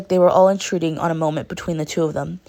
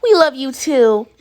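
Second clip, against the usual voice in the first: high and loud, a bit slow; squealing; 8 semitones higher, 7 dB louder, slower than usual. A narrator's reading. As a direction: gush it with affection. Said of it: "Mina squealed".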